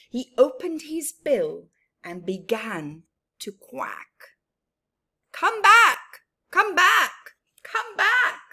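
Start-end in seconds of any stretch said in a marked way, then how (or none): 5.3-8.4 high, loud quacked call; quacking comeback bird. Acted. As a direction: call her back like a duck.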